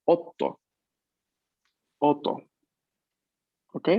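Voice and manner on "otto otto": otto with a held stop on the t; otto, doubled t; double t. Shown as a demonstration. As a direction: pause inside otto on the t. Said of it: "prestarle atención a las dobles consonantes".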